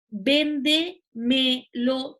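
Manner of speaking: véndemelo slowly, broken into ven-de-me-lo; syllable by syllable. Shown as a demonstration. Teacher showing how a word is built, syllable by syllable. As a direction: spell out véndemelo in separate, deliberate beats. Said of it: "una construcción como esta".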